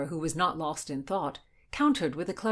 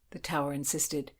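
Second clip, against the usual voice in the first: whisper; 5 semitones lower, 5 dB quieter than usual. A narrator's reading. Narration dropped to whisper.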